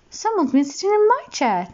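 high mummy bear voice; high, indignant mummy bear. A narrator's reading. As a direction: lift it up high for the mother bear. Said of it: "said Mummy Bear".

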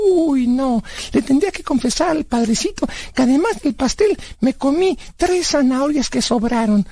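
put-on high-pitched kid balking at confessing; high cartoonish reluctance; childlike Blas, reluctant. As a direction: protest in a high, naive character voice.

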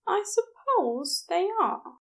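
high, shy and hopeful; tentative, voiced well up high; Aziraphale's voice. A narrator's reading. Shy nurse admitting he likes the nickname, high and hesitant.

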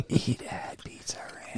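laughing fake ad whisper; sneaky whispered ad plug.

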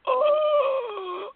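high, slow, mock-pained whimper; mock Loki whimper. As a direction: whimper like a beaten-up villain. Mocking Loki after the smash.

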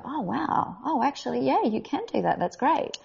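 high-pitched mock amazement; pleasantly surprised. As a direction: imitate an impressed casting director. Casting people pleasantly surprised, acted out.

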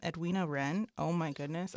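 ordinary talk, nothing marked

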